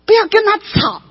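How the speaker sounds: high-pitched, forceful exhortation; impassioned preaching. Real, not acted. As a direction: drive the lesson home to the congregation. (高声激昂地劝勉会众)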